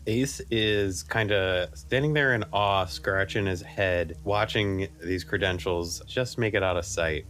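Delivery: ordinary talk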